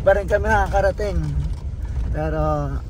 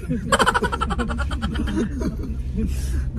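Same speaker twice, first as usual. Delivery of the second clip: laugh; 10 semitones higher than usual. Real, not acted. Laughing on bumpy road.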